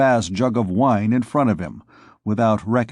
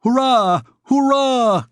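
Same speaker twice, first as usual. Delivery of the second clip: much higher, louder cheering shout; 13 semitones higher, 4 dB louder, slower than usual. Acted. Young scamp in armor cheering over the tavern uproar, shouting. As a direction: whoop it out like a boisterous young scamp. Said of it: "he was shouting".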